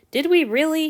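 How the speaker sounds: lightly voiced eager boy; young Hanyu. A narrator's reading. Hanyu asking Antony, a boyish lilt. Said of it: "he asked".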